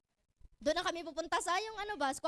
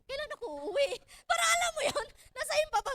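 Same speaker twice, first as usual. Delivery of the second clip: whiny crying; 7 semitones higher, 6 dB louder than usual. Retold or imitated. Retelling crying on plane.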